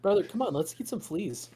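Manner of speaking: a hint of an eager monkey brother; monkey buddy.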